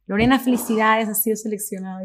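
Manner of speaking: high, announcing phone-call delivery; congratulating director. Retold or imitated. Director calling to announce she got the part, congratulatory.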